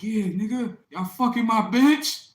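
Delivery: high, loud, slow confrontation yell; retold shout. Chris confronting the guys at the store, shouting. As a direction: bark it at the guys like a jealous boyfriend. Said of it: "he goes up to the guys like".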